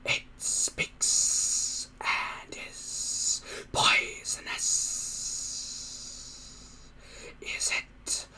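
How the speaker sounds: slow, breathy monster whisper; a slow hissing creature voice, wary; creepy creature whisper. Acted. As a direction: muse to yourself like a suspicious beast. Creature musing over Hiccup.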